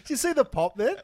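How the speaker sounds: high, loud, thrilled question; rising, gleeful ribbing; excited. Real, not acted. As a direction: call out the flex like a hyped fan. Mates egging on a flex.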